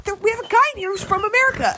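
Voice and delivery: high, fast announcement; imitated excited classmate. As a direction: imitate a girl eagerly sharing news.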